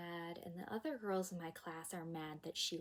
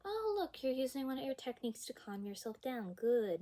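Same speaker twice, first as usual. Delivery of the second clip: high, louder praise as if nothing happened; breezy, oblivious teacher; 6 semitones higher, 5 dB louder than usual. Retold or imitated. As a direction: imitate a teacher blithely glossing over it. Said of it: "like none of this happened".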